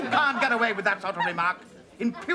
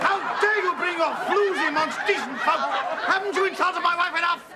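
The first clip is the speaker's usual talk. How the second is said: outraged yelling; 8 semitones higher than usual. Acted. Husband shouting down the guest and the restaurant, outraged yelling. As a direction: rant like a pompous man whose honour is wounded.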